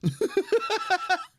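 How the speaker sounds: real laugh, high and bright; high burst of laughter. Real, not acted. Gamer laughing at his own troll joke, big laugh.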